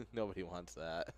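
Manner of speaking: ordinary talk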